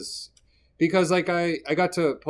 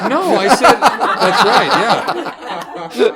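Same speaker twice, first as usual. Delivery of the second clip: laughing through the words, much higher and louder; 9 semitones higher, 12 dB louder, much slower than usual. Real, not acted. Guest retelling his joke to hosts, laughing hard. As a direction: giggle out the punchline.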